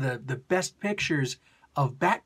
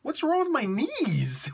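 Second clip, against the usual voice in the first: dazed brain voice; 9 semitones higher than usual. Retold or imitated. The brain after a jaw hit, high confused mock voice. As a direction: play the baffled brain. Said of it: "Your brain is just like".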